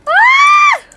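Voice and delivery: short, loud high-pitched shriek; sharp high scream. Real, not acted. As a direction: let out a sudden piercing yelp. Startled shriek alone, far above usual.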